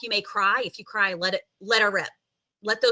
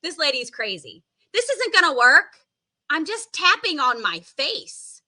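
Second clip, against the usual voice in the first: high-pitched, incredulous doubter; playful mock indignation, pitch swooping up; mock skeptic; 8 semitones higher than usual. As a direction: act out a scoffing student's disbelief. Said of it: "Maybe you think I'm crazy".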